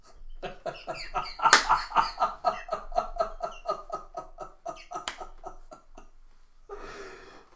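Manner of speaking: high, helpless laughter; real laughing fit. Real, not acted. Teller cracking up at the story, real laughing fit. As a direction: crack up for several seconds.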